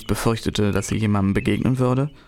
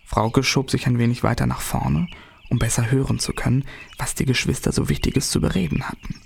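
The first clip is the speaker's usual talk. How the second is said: usual speaking voice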